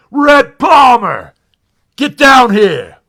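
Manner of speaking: slow, very loud, high bellow; booming shout. Retold or imitated. Lasorda shouting the reporter down from the stands. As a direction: summon him like a drill sergeant.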